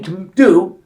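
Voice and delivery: rising pitch as the outrage builds; indignant retort. Retold or imitated. Retold heated retort to counselor.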